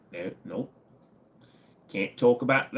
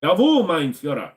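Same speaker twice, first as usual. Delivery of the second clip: mock German accent; 5 semitones higher, 6 dB louder than usual. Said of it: "Germans having a good time".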